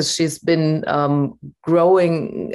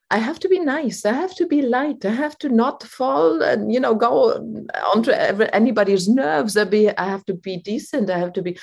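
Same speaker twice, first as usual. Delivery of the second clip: high, light, people-pleasing voice; light, nice-girl voice; 6 semitones higher than usual. Retold or imitated. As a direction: imitate a woman trying hard to be nice. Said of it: "that lightness in the voice".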